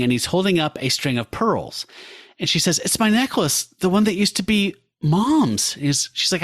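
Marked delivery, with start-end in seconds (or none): 2.8-5.7 higher, lightly voiced heroine moved; touched Jen. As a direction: pitch it up, a hint of wonder.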